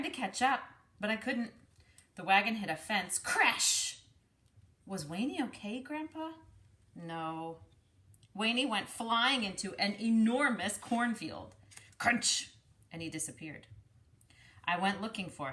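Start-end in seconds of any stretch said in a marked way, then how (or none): 3.2-4.0 crash effect. Acted. Wagon hits fence. 12.0-12.5 crunch effect. Acted. Into cornfield.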